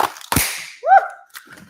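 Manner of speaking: breathy high whoop of triumph; cheer. Real, not acted. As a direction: squeal with delight at the cracker popping. Finally pulls the cracker.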